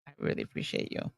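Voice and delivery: whispered thanks. Real, not acted. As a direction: breathe it out softly. Emotional thanks, near tears.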